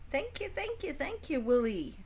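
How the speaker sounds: thrilled high-pitched gratitude; excited thanks. Real, not acted. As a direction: gush with delight. Thanking friend for gift.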